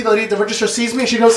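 usual speaking voice

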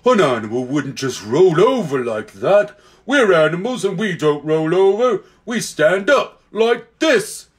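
lumbering bear talking. A narrator's reading. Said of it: "said one of the bears".

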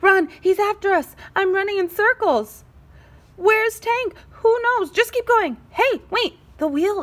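breathless fleeing; frantic chatter. Acted. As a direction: panicky squeals. Characters fleeing in panic.

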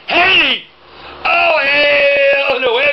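loud, stretched hell no; appalled at being asked to donate; shocked refusal. Real, not acted. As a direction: balk loudly and slowly. Recoils at donation request.

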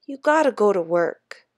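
a hint of his father's deep voice; boy trying to sound deep and sure; deep certain voice. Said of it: "trying to make his voice deep and certain like his father's".

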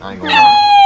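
sudden loud high shout. Real, not acted. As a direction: yell it out. Shouted outburst.

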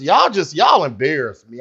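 ordinary talk, nothing marked

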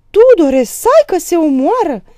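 high, loud, panicked scream; choked cry. A narrator's reading. Mother calling husband to stop the fight, choked cry. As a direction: cry out as if choking with alarm. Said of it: "strigă Aristița înăbușit".

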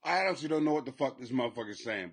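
usual speaking voice

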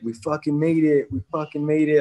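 overjoyed coach, tears in his eyes; retold coach celebrating. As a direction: relive the coach bursting in with the news.

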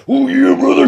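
high, loud wrestling-promo shout; hammed-up wrestler catchphrase; Macho Man Randy Savage. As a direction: blast it like a hyped wrestler. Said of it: "Shut up, Macho Man".

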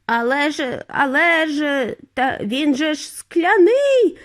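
slow stammered protest rising high; splutter, then cry out; panicky, drawn-out objection; alarmed cry. A narrator's reading. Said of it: "закричав дідунь Джо".

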